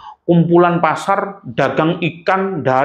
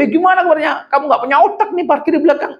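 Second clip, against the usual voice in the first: mock Egyptian banter; faster than usual. Mimicking Egyptian joking greeting.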